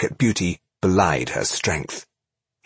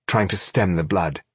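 ordinary delivery

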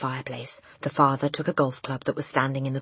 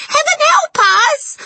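slow, high, loud wail; terrified cry; 12 dB louder than usual. A narrator's reading. Mother clutches her husband, terrified wail. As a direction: wail for help in terror. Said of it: "cried the mother".

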